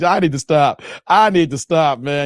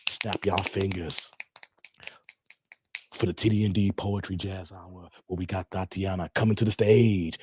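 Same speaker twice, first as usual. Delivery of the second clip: strip club DJ voice; 5 semitones lower, 3 dB quieter than usual. Pretend. Host bringing Tatiana to the stage, strip club DJ voice. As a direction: announce the act like a club DJ. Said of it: "sound like a strip club D J".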